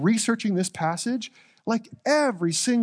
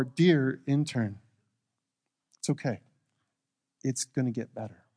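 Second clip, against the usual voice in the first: compassionate; 7 semitones lower, 5 dB quieter than usual. Pretend. Speaker comforts a failing intern, softly and gently. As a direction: comfort someone who failed. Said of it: "with compassion".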